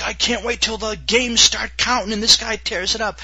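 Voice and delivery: high, excited fan voice; mock hype fan. Mocking spring training optimists with a high excited voice. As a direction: gush like a fan full of hope.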